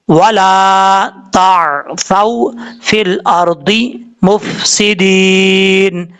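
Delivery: slow, drawn-out tajweed recitation; Quranic recitation. Shown as a demonstration. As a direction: recite each letter slowly and fully. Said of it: "इस तरीके से पढ़ना है". (धीमी तजवीद वाली तिलावत)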